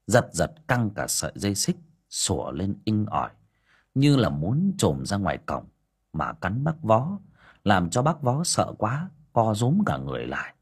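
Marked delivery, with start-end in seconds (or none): none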